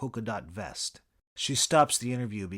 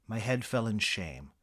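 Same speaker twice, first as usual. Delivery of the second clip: usual speaking voice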